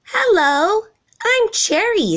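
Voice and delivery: high, bright-eyed little giraffe voice; young giraffe. A narrator's reading. Young giraffe calls from above.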